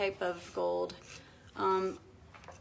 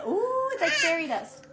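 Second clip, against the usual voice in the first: delighted; 9 semitones higher than usual. Real, not acted. Glitter falls on the bed.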